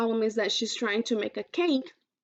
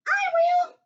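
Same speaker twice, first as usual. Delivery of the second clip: very high, louder eager cry; eager cat; 6 dB louder than usual. Acted. Hungry cat crying out for cake, very high and eager. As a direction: jump at the chance.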